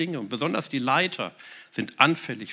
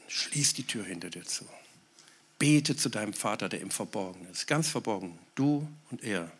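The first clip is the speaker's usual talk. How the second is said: intimate hush; 4 semitones lower, 5 dB quieter than usual. Acted. Intimate prayer description.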